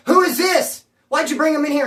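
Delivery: high, loud mock interrogation; imitating angry demand. Motel crew asking her about the uninvited man, imitated angry demand.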